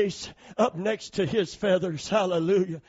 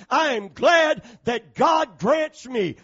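slow, loud, high preaching shout; 6 semitones higher, 10 dB louder, slower than usual. Real, not acted. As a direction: lift it up and shout it slowly.

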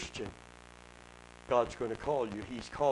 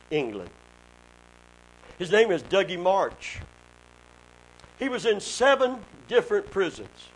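loud, high fervent preaching; 5 semitones higher, 8 dB louder than usual. Acted. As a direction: preach it out to the congregation. Preaching the testimony at full force.

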